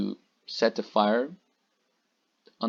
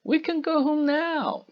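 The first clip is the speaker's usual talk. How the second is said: high, excited. A narrator's reading. Gretel's joy continues.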